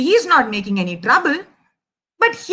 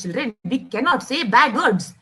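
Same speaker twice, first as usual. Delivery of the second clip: ordinary delivery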